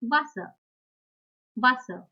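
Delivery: "Wasser" with W like v; German example word. Shown as a demonstration. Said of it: "For example".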